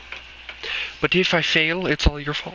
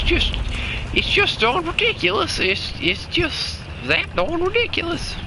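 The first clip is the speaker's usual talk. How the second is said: squeaky hillbilly; 12 semitones higher than usual. Pretend. Character voice complaining.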